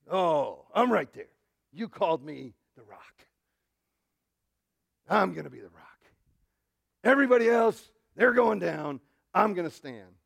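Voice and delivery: loud, cocksure disciple acted out; puffed-up bravado, pushed loud; boastful Peter. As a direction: play a disciple bragging he will outlast everyone. Preacher acts out boastful Peter.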